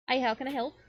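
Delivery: a hint of a customer-service greeting; shopkeeper greeting. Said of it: "and the woman goes".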